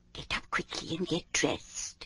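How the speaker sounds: soft hushed whisper. Acted. Hetchel waking Bobbin before dawn, whispering. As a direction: whisper so nobody else wakes.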